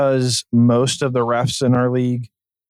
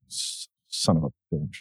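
low muttered curse, much softer; muttered under breath; 9 semitones lower, 9 dB quieter than usual. Retold or imitated. Coach cursing the referee after a warning, muttered low. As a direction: grumble it under your breath, barely voiced. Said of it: "talk under their breath".

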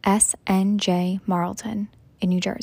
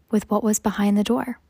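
ordinary talk

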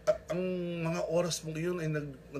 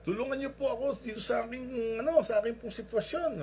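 high, raised pleading voice; pleading loudly; 9 semitones higher, 4 dB louder than usual. Retold or imitated. Hanging man explains his plight to God, pleading loudly. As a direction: plead your case upward.

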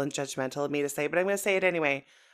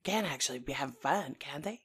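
impression of Mary; 3 semitones higher, much faster than usual. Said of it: "we just pan over to Mary".

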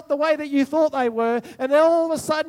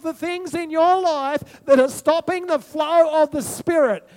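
louder, slower, rising preacher's build; preaching at full force; 4 semitones higher, 5 dB louder, slower than usual. Acted. Pastor rousing the congregation about living water, fervent preaching.

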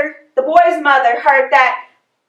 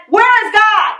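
higher, pushed mock booming question; hammed-up stern pastor, pitched up; sterner pastor; 7 semitones higher than usual. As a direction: play the huge pastor demanding an answer. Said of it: "in a much sterner voice".

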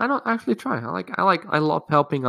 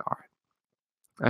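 whispered; 8 dB quieter than usual. Retold or imitated. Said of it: "I'm like".

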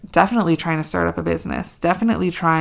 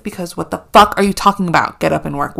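ordinary delivery

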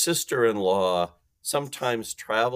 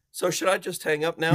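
mock timid offer; 7 semitones higher than usual. Shown as a demonstration. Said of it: "That doesn't work".

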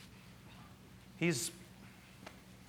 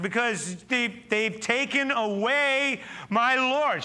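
Mary's grief; 9 semitones higher, 5 dB louder, much slower than usual. Acted. Pastor voices grieving Mary.

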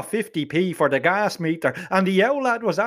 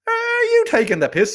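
incredulous. Acted. Incredulous retort to student.

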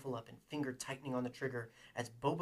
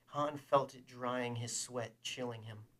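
ordinary delivery, nothing marked

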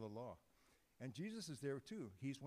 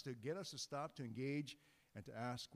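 ordinary delivery

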